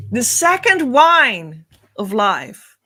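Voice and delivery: usual speaking voice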